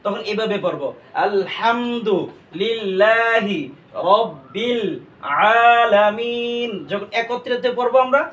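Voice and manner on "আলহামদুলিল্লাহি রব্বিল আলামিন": slow, word by word; আলহামদুলিল্লাহি, রব্বিল, আলামিন, each part set apart; broken-up recitation. Shown as a demonstration. Teacher breaks the verse into parts for learners, slowly. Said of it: "ভেঙে ভেঙে পড়ব".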